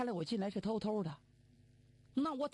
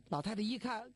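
ordinary delivery, nothing marked

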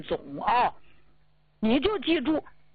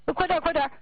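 higher, pressing hurry-up; anxious, higher "快点，快点"; urgent hurry; 7 semitones higher, faster than usual. Retold or imitated. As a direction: rush out the door. Rushing to neighbor's home.

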